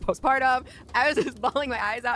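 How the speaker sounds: usual speaking voice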